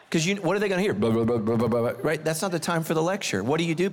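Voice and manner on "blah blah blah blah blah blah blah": garbled blah. Retold or imitated. What drowning kid hears.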